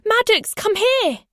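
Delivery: high, loud summons; crying out. A narrator's reading. Owner slapping thighs for the rabbit's attention, loud high call. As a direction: call a pet across the lawn. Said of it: "I cried out".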